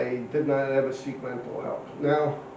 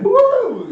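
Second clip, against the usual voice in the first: very high, loud whoop of surprise; startled whoop; 11 dB louder than usual. Retold or imitated. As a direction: let out a shocked woo. Demonstrating the feeling to Sammy.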